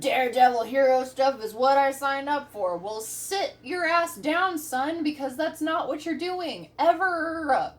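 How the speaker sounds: loud, emphatic telling-off; scolding. Acted. Dispatcher putting a cocky firefighter in his place, loud scolding. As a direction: hammer each phrase like a scolding parent.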